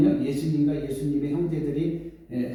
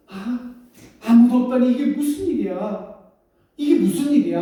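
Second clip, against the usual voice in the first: much higher, louder dismay; alarmed outcry; 9 semitones higher, 6 dB louder than usual. Acted. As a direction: cry out in shock. Mary alarmed nobody is there. (깜짝 놀라 외치며)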